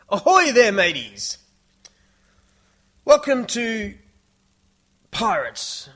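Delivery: high, loud, booming pirate greeting; pirate show host. Shown as a demonstration. Theme park host greeting the 3D show crowd, loud pirate hail. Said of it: "Here, hang on, here we go".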